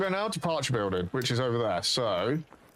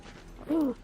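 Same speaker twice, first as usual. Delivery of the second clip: sharp gasp; 12 semitones higher, 3 dB quieter than usual. Real, not acted. Nearly falls down hole.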